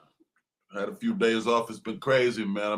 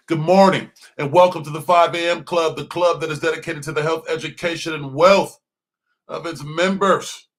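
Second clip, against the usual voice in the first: big announcer welcome, louder; radio host intro; 3 semitones higher, 5 dB louder than usual. Acted. Host to listeners, loud announcer welcome. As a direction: open the show like a hyped radio host.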